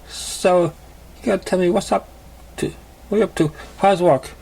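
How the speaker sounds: put-on cheerful old friend catching up; gentle female pony voice; young mare. Female character voice.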